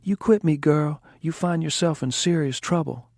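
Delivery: high, loud mock tough-guy voice; Raji impression. Linda imitating her manager Raji, high and loud. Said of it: "He goes".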